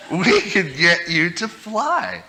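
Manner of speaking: slow, laughing sales talk; laughing pitch. Retold or imitated. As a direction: promise flight through giggles. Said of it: "I said".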